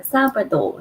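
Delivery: ordinary talk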